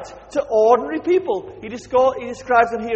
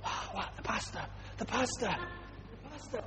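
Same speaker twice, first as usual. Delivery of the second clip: awed whisper; 3 semitones lower, 19 dB quieter than usual. Retold or imitated. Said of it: "everybody sort of".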